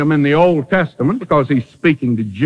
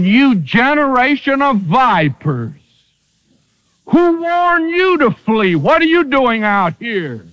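rising, fiery rebuke, much higher on "who warned you"; stern denunciation; 7 semitones higher than usual. Retold or imitated. John the Baptist rebuking Pharisees, in a fiery, high rebuke. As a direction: thunder at the crowd like a prophet.

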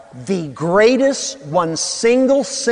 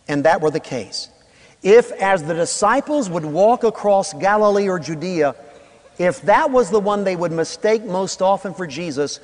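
normal talking voice

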